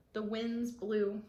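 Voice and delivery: usual speaking voice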